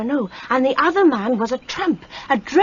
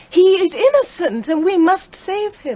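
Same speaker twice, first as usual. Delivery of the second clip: higher and louder, pleading urgently; urgent plea; 7 semitones higher, 5 dB louder than usual. Acted. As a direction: fight for him with rising voice. Girl pleads for her fiancé.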